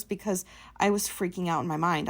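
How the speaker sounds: ordinary talk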